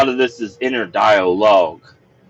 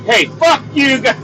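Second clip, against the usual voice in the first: angry yell; 11 semitones higher than usual. Retold or imitated. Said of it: "I'm like".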